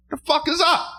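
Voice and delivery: way up high, pulling the sheet off; high shocked yell. Retold or imitated. To the parrots under the sheet, a high startled yell. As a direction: squeal it out in shock. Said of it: "I said".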